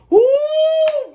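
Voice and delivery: high squealing ooh; excited ooh. Retold or imitated. Reacting to scare.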